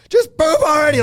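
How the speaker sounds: high, loud exasperated shout; frustrated yell. Retold or imitated. Parent shouting at constipated toddler, exasperated. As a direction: blast it like a fed-up parent.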